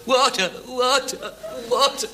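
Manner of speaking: high, slow, desperate gasp for water; parched croak. Acted. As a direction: beg for water like a dying man. Parched man begging for water.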